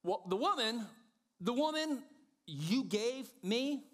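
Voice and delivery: high, incredulous blame, drawn out at the end; Adam passing the blame. Acted. Adam blames the woman to God, high and indignant. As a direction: raise the pitch sharply and stretch the accusation. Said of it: "Adam goes first".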